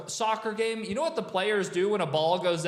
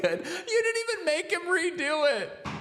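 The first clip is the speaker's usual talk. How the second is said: laughing; 10 semitones higher than usual. Real, not acted. Still laughing at the call.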